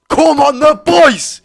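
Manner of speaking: full-voiced roar for the team; fan cheer. Real, not acted. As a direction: bellow it like a terrace chant.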